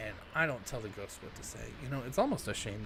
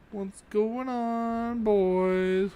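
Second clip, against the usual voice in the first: silly mock greeting; 7 semitones higher, 10 dB louder, much slower than usual.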